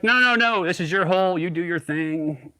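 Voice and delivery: high, then slowing and settling; mock polite refusal. Refusing Craig's offer with overdone politeness. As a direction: decline with exaggerated courtesy. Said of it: "I'm like".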